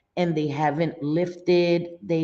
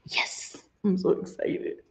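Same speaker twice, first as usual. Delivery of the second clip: softly breathed excitement; excited whisper; 3 semitones higher, 6 dB quieter than usual. Real, not acted. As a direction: whisper it like a secret thrill. Gushing about a coming gift.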